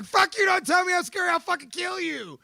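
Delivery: high, loud threatening yell; furious shout. Retold or imitated. Man replaying his rage at people calling him scary. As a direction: snap back with a threat, full volume. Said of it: "I'm like".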